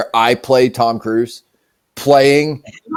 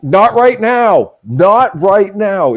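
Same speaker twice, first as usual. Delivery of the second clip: higher, drawn-out call to hold off; calling out, put-off; 4 semitones higher, much slower than usual. Acted. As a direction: wave off an interruption, stretching each word. Acts busy president calling out to aide.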